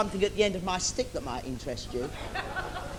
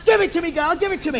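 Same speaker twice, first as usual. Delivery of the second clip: demanding; 7 semitones higher, much faster than usual. Acted. Villain demands lamp.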